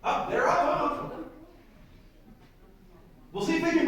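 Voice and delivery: high, eager cheering on; excited. Real, not acted. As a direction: urge them on excitedly. Fleas start pulling the chariot.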